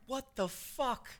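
drawn-out high disbelieving what the fuck; stunned disbelief. Retold or imitated. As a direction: stretch it out, high and baffled. Stunned reaction quote.

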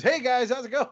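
high, bright-eyed channel intro greeting; imitating a friend's "hey guys" intro; YouTuber intro impression. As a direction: mimic his cheery video opener. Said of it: "just like the beginning of his videos".

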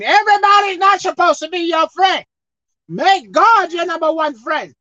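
high, loud, stressing Everybody's; loud emphatic repeat; preached shout. Real, not acted. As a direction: call it out to the church. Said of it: "I'll say it again".

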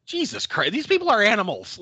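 disgusted outburst. Retold or imitated. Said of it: "I was like".

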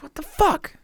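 high, exasperated mock rant; retold outrage. Retelling his outburst about the plumber, high and exasperated. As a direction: vent disbelief at the useless plumber. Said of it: "I'm like".

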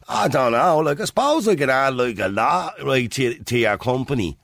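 mock interviewee in a lazy Dublin accent; parodied lazy Dublin accent, stumbling to find an answer. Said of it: "If you have an accent like that".

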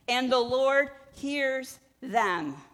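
slow, loud, deliberate verse; proclaimed scripture. Acted. Preacher reading the psalm to the church, slow and loud. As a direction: lean on every word of the verse.

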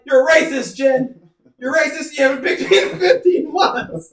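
mock heckler yelling at the press secretary; mock accusation. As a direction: shout it like a heckler at the briefing. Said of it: "he'd be like".

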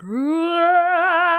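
loud, high, rising "raah"; full-belly roar. Retold or imitated. Client finally letting go for the healer, all-out held roar. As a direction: let a long roar rip from the belly. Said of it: "I really go at it from my, the bottom of my belly".

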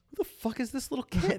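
high, baffled friend voice; confused buddy. Retold or imitated. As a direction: ask it like a lost bystander. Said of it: "he turns to me and goes".

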